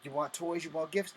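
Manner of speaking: ordinary talk, nothing marked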